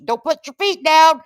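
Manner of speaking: high, loud hammed-up alarm; mock creeped-out warning. Host spooked by clowns, mock-alarmed warning. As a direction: warn as if clowns lurk under the bed. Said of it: "I get a little creeped out".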